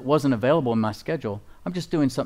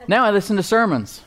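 higher, louder preaching burst; fervent preaching; 7 semitones higher, 5 dB louder than usual. Acted. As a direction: proclaim it with joy.

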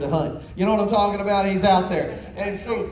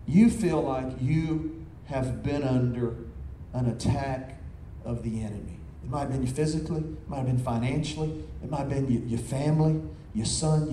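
lower, softer pastoral appeal; soft altar call; 4 semitones lower, 5 dB quieter than usual. Acted. Preacher inviting the hurting to raise hands, soft appeal. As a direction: draw the congregation in gently.

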